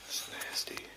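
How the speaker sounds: hushed, frightened whisper; scared whisper. Real, not acted. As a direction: breathe the words, afraid to be heard. Alone among bones, scared.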